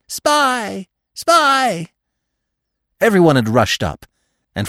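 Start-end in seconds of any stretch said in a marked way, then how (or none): 0.1-1.9 very high crow caw. A narrator's reading. Crows overhead accusing the dog, high cawing cry. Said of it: "the crows cawed".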